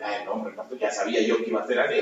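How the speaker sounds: soft, low, half-laughing through tears; a touch of tearful joy, voice dropping low; tearful laughing relief. Retold or imitated. Healed man thanks his pastor, laughing softly through tears. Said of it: "estaba llorando de".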